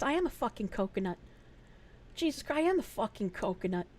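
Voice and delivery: hushed, confused thinking aloud; self-reproachful muttering. Real, not acted. Streamer working out her veal mistake, quiet muttering to herself.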